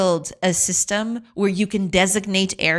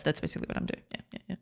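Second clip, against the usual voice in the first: quiet; 3 semitones lower, 14 dB quieter, much faster than usual. Real, not acted. Voice drops away from mic.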